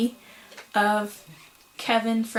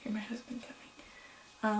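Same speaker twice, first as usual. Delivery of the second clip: slow hushed whisper; 15 dB quieter, slower than usual. Real, not acted. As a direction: whisper it so he won't hear. Husband approaching, hushed aside.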